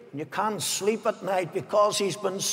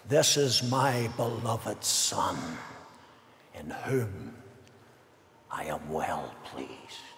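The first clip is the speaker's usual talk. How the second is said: much lower and softer, slow, fading to a hush; voice of God the Father; 11 semitones lower, 8 dB quieter, slower than usual. Acted. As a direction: intone it like a distant voice from heaven, dying away. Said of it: "And the Father's voice said".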